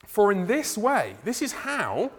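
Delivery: preaching emphasis. Acted. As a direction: lean into the verse. Reads verse with emphasis.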